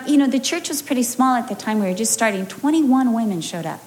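ordinary talk, nothing marked

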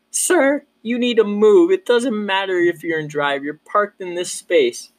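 high mock-stern ranger voice; stern partner. Partner confronts illegally parked driver, high imitated stern order. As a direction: imitate a no-nonsense officer, pitched well up. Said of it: "She goes".

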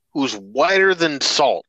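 ordinary talk, nothing marked

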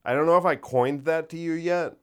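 ordinary talk, nothing marked

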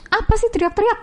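high-pitched imitation of a mom telling a kid off; scolding Batak mom. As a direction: snap back like an annoyed Batak mom. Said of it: "Yang asli, yang ngomel ya?".

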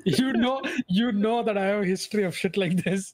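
playfully outraged protest; mock outrage. Mock indignant outburst.

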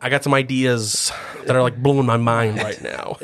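low, intense pitch; intense self-serious writer. Acted. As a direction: play the grave creative genius, lightly. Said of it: "He's so intense".

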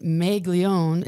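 slow Minnesota "Maglione", first vowel like "may"; Minnesota accent. Retold or imitated. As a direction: draw out "Maglione" as "May-glione", Minnesota style. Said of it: "I had a horrible Minnesota accent".

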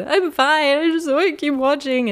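high, teary insisting; tearful. Retold or imitated. Insisting to her husband she's fine while crying. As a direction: force the words out while still crying. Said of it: "I was crying so, so much".

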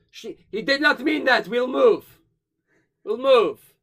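smooth it over with them fast; nervous captain pleading loudly to move aside; placating captain. Acted. Pitcher placating the dragonborn ship.

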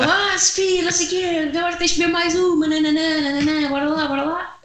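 high, fast, teasing friends' voice; pushy friends voice. Retold or imitated. Friends pushing her to drink.

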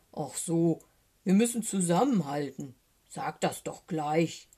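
lightly voiced bear, slowing at the end; understanding bear. A narrator's reading. Eddie Bär agrees to stick together, bear voice. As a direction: say it as a firm, relenting bear.

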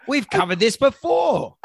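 laughing through the words; high amused laughter. Real, not acted. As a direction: crack up while saying it. Laughing at green puppy.

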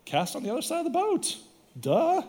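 high, light, know-it-all tone; mock obvious. Jesus telling fishermen where to cast, high and teasing. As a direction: say it like it's obvious.

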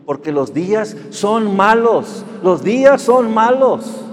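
high, loud and slow preaching; proclaiming scripture. Acted. Pastor proclaiming the verse to the church, loud and slow. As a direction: hammer each phrase of the verse home.

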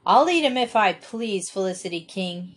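usual speaking voice

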